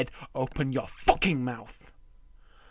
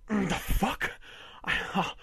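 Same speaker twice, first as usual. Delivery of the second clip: ordinary talk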